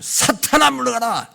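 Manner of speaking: high, loud acted command; reenacted sharp dismissal; stern rebuke. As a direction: imitate a forceful banishing rebuke. 예수의 꾸짖음을 연기.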